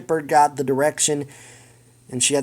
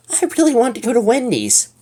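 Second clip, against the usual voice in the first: pleading; 9 semitones higher than usual. Acted. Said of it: "Mabel pleaded".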